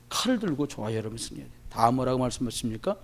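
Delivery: usual speaking voice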